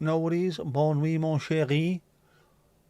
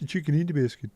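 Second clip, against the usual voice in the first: Swedish chef voice; 3 semitones higher, faster than usual. Retold or imitated. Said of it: "like the Swedish chef".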